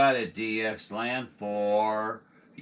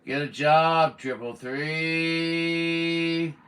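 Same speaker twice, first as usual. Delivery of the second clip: louder and higher, three drawn out very slowly; mocking taunt; 7 semitones higher, 5 dB louder, much slower than usual. Real, not acted. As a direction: stretch the last word into a jeering sing-song. Scolding rude caller on air.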